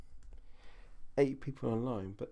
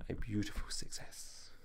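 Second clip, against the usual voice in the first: hushed admiring whisper; 8 dB quieter than usual. Real, not acted. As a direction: breathe it like a secret. Admiring the new rig.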